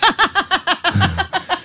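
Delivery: helpless high-pitched laughter; high giggling laugh. Real, not acted. Host amused by his own earpiece bit, high giggling.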